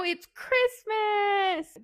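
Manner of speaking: high, drawn-out festive cheer; playful Christmas fanfare; festive announcement. Acted. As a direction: sing out the holiday, slow and high. Mock festive announcement in recap.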